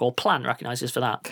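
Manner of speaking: ordinary talk, nothing marked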